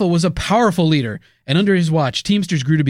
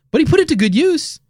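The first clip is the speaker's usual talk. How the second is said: sarcastic; 12 semitones higher than usual. Pretend. Ironic high lift.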